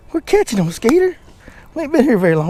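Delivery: excited dog talk. Real, not acted.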